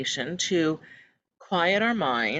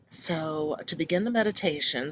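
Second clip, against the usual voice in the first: ordinary talk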